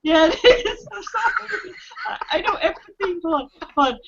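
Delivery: high giggling apology; laughing while talking. Real, not acted.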